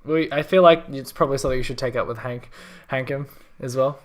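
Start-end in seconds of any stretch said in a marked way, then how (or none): none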